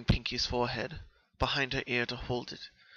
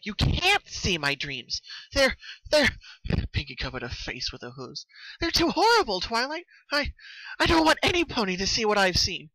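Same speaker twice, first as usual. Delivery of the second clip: desperate, higher and louder pleading on the edge of tears; trembling voice; 9 semitones higher, 6 dB louder than usual. A narrator's reading. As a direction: beg her not to look, voice shaking. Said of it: "Her voice was trembling".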